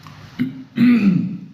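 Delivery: loud, high throat clear. Real, not acted. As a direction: clear a hoarse throat hard. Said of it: "il y a un peu une voix rauque".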